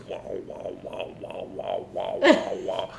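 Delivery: distorted low wah wah voice; droning wah. Retold or imitated. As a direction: make the voice sound like a low muffled drone. Customer's voice heard through high.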